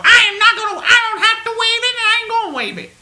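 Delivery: high, very loud caricature; mocked defiant manager. Manager refusing the caller's waiver, loud high mockery. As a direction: refuse outright in an exaggerated yell. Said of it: "she said to me".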